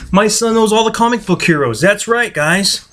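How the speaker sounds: high, laughing parent voice. Real, not acted. Talking with toddler son.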